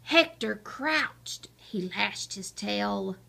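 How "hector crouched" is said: plain reading voice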